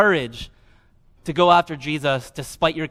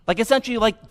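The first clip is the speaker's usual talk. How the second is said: normal talking voice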